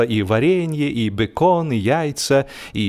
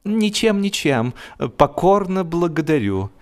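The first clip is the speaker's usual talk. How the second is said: high, anxious dog voice; a timid dog hastily declining; nervous dog; 7 semitones higher than usual. A narrator's reading. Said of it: "Монморанси".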